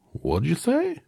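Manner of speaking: very high, puzzled straw man; Scarecrow voice. A narrator's reading. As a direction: squeak out a baffled question.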